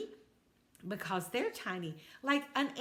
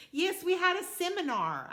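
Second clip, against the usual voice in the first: high, loud, pleased toad voice; proud little toad; 9 semitones higher, 5 dB louder than usual. Acted. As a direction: announce it like big news. Toad proudly reports seminar.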